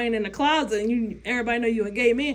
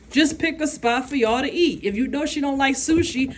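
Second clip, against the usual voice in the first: ordinary talk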